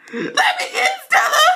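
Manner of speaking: very high, loud squeaky cartoon shout; shouting character voice. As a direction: screech it like an angry cartoon kid.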